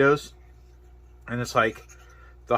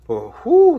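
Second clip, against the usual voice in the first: hot exhale; 13 semitones higher, 9 dB louder than usual. Real, not acted. Reacting to spicy heat.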